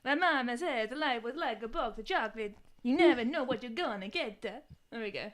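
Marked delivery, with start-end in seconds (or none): 0.2-4.4 American film quote turned Italian; Italian accent. Acted. Friend redoing the quote after objections, Italian accent. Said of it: "you did that in Italian".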